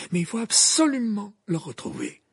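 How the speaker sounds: high, loud, firm appeal; urgent insistence. Acted. Dumbledore urges Moody to find Croupton, urgent.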